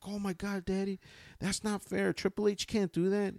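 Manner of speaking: high-pitched indignant child imitation; worked-up kid voice, high; excited little kid. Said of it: "Junior got really excited".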